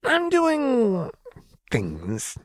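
put-on very high, giddy unhinged girl voice; manic Jinx. A narrator's reading. Jinx toying with Vi, manic and high. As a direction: play her unstable and playful.

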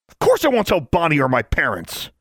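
sudden high-pitched indignant yell. A narrator's reading. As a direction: yell it at your friend. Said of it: "he yelled at her".

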